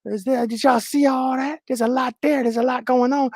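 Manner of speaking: high, thin mock onlooker voice; squeaky put-on busybody chatter; imitating gossiping viewers. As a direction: mimic scandalized viewers in a high voice. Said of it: "let me see who's in here, be like".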